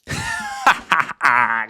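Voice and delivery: high burst of laughter; high, staccato laugh. Real, not acted. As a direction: laugh out at a joke. Laughing at the joke.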